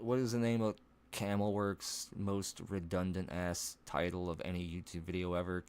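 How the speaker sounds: ordinary talk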